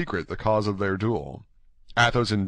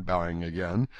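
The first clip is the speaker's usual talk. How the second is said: usual speaking voice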